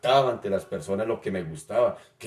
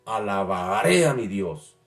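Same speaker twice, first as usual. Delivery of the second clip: fervent praise reading; 3 semitones higher, slower than usual. Shown as a demonstration. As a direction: read it like you mean every word before God. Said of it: "¿Cómo lo leería yo?".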